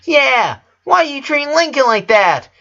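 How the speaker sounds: put-on high, loud little girl voice, indignant; a child sticking up for her brother; Lena, a little angry. A narrator's reading. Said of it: "looking at her a little angry".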